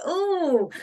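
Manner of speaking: surprised ooh. Retold or imitated. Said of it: "it brings up stuff where you're like".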